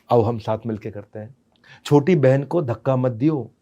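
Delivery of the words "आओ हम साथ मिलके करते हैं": softer and lower, kind; gentle instruction. Shown as a demonstration. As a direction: coax the child sweetly. Supportive alternative.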